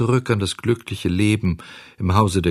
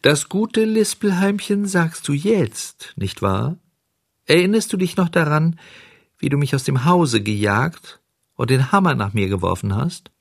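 high, small voice, chiding; reproachful little cricket; 6 semitones higher than usual. A narrator's reading. Cricket reminds Bengele of the hammer, chiding. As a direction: take him to task in a tiny voice.

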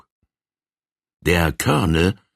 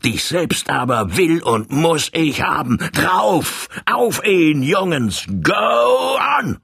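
building from angry to a much higher battle cry; rallying shout; 8 semitones higher than usual. Acted. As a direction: whip the gang into attacking. Colonel rallies his gang.

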